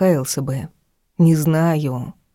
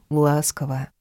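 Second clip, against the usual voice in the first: plain reading voice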